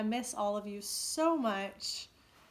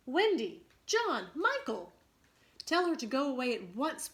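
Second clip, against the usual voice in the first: louder, urgent calling of names; 5 semitones higher, 6 dB louder than usual. A narrator's reading. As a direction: call out to Peter in alarm. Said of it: "the three called simultaneously".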